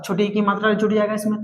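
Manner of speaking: ordinary talk, nothing marked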